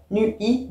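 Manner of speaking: "Nu-i" broken into two syllables, nu and i; diaeresis. Shown as a demonstration. Teacher counts syllables in verse, "Nu-i" split in two. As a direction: pull the vowels of "Nu-i" apart into two beats.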